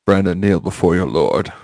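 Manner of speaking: low creepy lordly command; slow theatrical villain menace. Acted. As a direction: summon him like a dark lord. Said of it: "Oh, this is so creepy".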